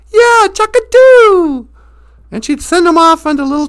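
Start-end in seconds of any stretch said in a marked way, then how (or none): 0.1-1.7 slow, loud, high, dopey eager voice; Chaka voice. Retold or imitated. Chaka eagerly agrees to Holly's errand, dopey and slow. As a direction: agree happily without a thought. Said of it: "he goes".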